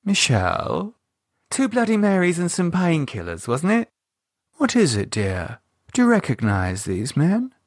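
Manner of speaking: a posh lady gently prying; Cynthia's voice. Acted. Cynthia asking pale Michelle about the men, genteel and concerned.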